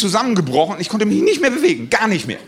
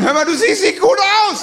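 much higher, called across the hall; calling out Niki; 14 semitones higher, 3 dB louder than usual. Retold or imitated. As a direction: shout over from far off as the fat friend. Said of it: "rief er dann".

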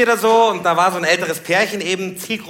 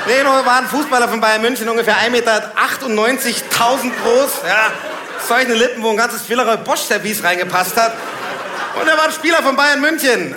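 loud, high-pitched comic outrage; exasperated rant; 7 semitones higher, 6 dB louder than usual. Real, not acted. Comedian mistaken for a footballer protests, loud outrage. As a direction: rant it out, rising to a shout.

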